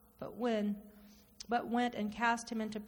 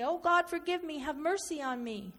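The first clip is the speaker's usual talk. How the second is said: pleading cry to God; 6 semitones higher than usual. Retold or imitated. Speaker retells his own prayer again.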